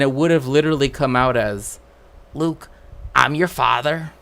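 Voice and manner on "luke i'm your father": put-on 1 West Country accent. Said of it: "had him say it in the intonation".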